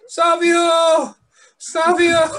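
high, loud jokey yelling of the name; calling out. Acted. Co-host yelling a wrestler's name as a joke, loud. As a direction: shout it like a fan in the crowd.

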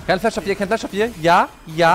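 loud, high urgent callout; shouted callout. Real, not acted. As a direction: yell the info to the team. Callout im Fight. (laut ins Team rufen)

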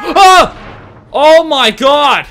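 sudden high, loud scream of fright; jolted by a jump scare, cry out; real startled scream. Real, not acted. Streamer jump-scared by the game, screams.

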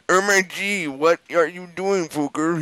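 high, hammed-up scolding yell; angry professor. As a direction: play it as a mock-outraged teacher. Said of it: "He shooted angrily".

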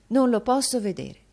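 teacher distressed at a crying pupil, agitated. A narrator's reading. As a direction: burst out, unable to bear it. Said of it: "in tono d'agitazione".

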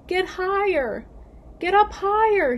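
high, loud warning cry; urgent shout. A narrator's reading. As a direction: shout it up to a pilot in danger. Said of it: "he shouted".

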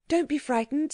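put-on high, girlish reassurance; reassuring girl. A narrator's reading. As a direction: soothe a frightened companion in a high voice. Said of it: "said Anthea".

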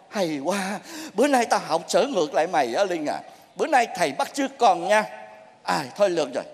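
low, softened, fed-up scolding; tired master washing his hands of it; weary resignation. Acted. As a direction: give up on the pupil with a sinking voice. Master wearily gives up on pupil.

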